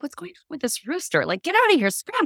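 hammed-up fright at a rooster; mock scared. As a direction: shoo the rooster off in a playful panic. Reacting to a rooster crossing.

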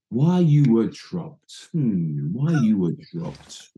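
slow, musing manager voice with a hum; drawn-out put-on Dutch manager; imitating Ruud Gullit. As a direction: manager mulling the question while walking. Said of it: "as he's saying".

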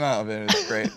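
normal talking voice

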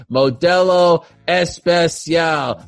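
very slow, drawn-out sponsor announcement; announcer ad read. Acted. Host kicking off the beer sponsor read, announcer style. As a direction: stretch the brand name out grandly.

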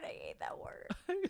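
hushed whisper of disgust. Real, not acted. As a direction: breathe it out in disgust. Disgust at the word.

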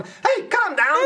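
high, drawn-out mock Scouse; Scouse-like accent. Imitating the werewolf boss calming his pack, Scouse-like accent. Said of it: "sounds very much like what you've described as a Scouser".

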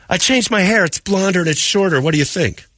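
hammed-up woman showing off new hair; preening coworker. Coworker shows off her new hair to him, high mock preening.

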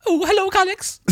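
very high put-on Swedish voice; Swedish accent. Swedish girl greeting him in the accent game, high Swedish accent.

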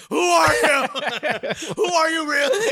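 retelling meeting the new girl, in laughing mock disbelief. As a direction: laugh through the question as if you're stunned. Stunned by an English-accented classmate.

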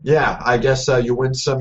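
ordinary delivery

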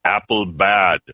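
stiff, choppy caveman-robot voice; robotic voice. Acted. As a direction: say it like a robot, word by word. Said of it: "Don't you like his robotic voice?".

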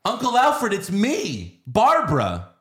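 put-on high, loud girlish pleading; mock Barbara. Mocking Barbara greeting robot Alfred, high and loud. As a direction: squeal it like a naive heroine. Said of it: "And she goes".

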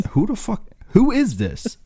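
high mock confused yelp; mock startled Mark Hamill. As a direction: squawk it like a startled celebrity. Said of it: "he's like".

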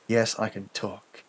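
soft, halting murmur; weak reply. A narrator's reading. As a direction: answer faintly from a sickbed. Wounded narrator answers surgeon.

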